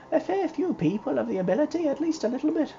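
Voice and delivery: high, chirpy and innocent; high girlish young lady. Acted. Ivy chatters about her day to Mr Ward, high and giddy. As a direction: speak like a cheerful child-like young woman.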